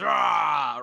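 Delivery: sudden loud zombie growl shout; jump-scare roar like a crawling zombie. Retold or imitated. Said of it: "crawls at people and goes".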